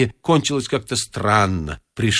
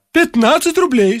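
put-on old man; 13 semitones higher, 5 dB louder than usual. A narrator's reading. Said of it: "спустил цену Варфоломеич".

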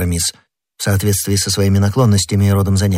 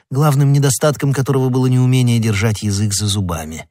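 ordinary delivery

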